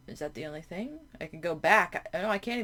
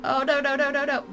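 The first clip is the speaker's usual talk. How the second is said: higher, louder frantic denial; panicked; 6 semitones higher, 4 dB louder than usual. Real, not acted. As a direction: panic as it grabs you. Grabbed by tentacle.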